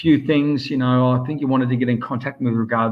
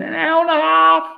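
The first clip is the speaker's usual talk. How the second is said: exasperated retort; 3 dB louder than usual. Retold or imitated. Retold reply to sleepy mate.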